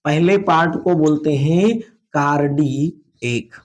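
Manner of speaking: slow, drawn-out words for writing down; slow dictation. Shown as a demonstration. Teacher writing in Hindi with pupils, slow dictation. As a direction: stretch each word for note-takers. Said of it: "Hindi में लिख लेते हैं".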